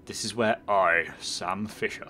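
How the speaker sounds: mild put-on gruff spy hero; hint of a posh secret agent; Sam Fisher. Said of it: "it's a bit posh".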